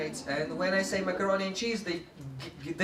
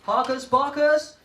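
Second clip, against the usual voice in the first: teacher call; 8 semitones higher, 5 dB louder than usual. Shown as a demonstration. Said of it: "For example, I say".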